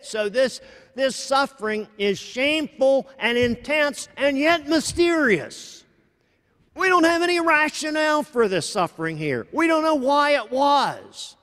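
mounting sermon intensity; rising preaching fervour. Acted. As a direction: push it up like a pulpit climax. Climax of sermon point.

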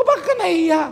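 asking the giver why he is embarrassed; puzzled question. Retold or imitated. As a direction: ask in honest bewilderment. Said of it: "Sabi ko".

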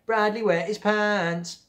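high, slow, sing-song taunt; teasing. Acted. Laurie taunting Bradley, slow sing-song tease. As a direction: drag it out to mock him. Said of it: "teased Laurie".

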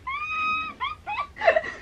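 breaks into a high, helpless laugh; high burst of laughter. Real, not acted. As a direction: squeal of laughter at the joke. Cracks up at the joke.

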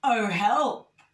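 loud little cry for help; hammed-up plea; mock alarm. A narrator's reading. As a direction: call out in storybook fright. Little Bear suddenly in trouble.

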